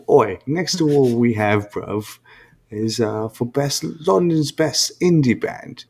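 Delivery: loud, high mock Cockney host voice; mock London accent. Cohost copying the London accent to present the indie award.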